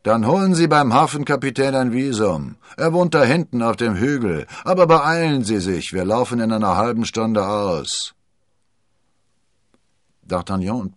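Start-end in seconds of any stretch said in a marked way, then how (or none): 0.0-8.1 loud captain's instructions; raised, commanding seafarer's voice; gruff ship's captain. A narrator's reading. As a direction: call out orders from the deck.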